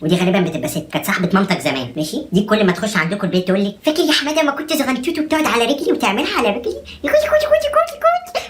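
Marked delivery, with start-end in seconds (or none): 3.8-6.7 high, sing-song auntie voice; old auntie cooing. Retold or imitated. 7.0-8.4 very high squeaky coo; squeaky baby talk. Retold or imitated.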